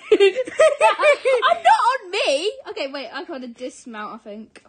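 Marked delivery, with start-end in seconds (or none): 0.0-2.5 words squeezed out through laughter; laughing. Real, not acted. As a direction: laugh through it, slow and high. Laughing fit while mounting horse.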